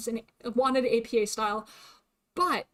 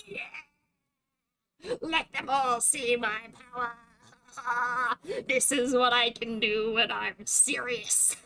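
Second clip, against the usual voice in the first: gloating villain; much slower than usual. Acted. Gloats over reclaiming top ranking.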